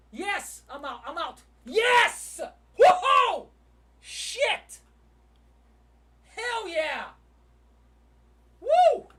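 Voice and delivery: very loud, high victory whoops; real ecstatic shouting; elated yelling. Real, not acted. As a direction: yell in triumph at a trade win. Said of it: "Sorry for yelling".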